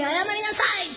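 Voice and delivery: sharp, high scolding shout from a retold angry father; imitating an angry father's stern command. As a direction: bark the order at a naughty child. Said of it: "もう火のように怒ったんです".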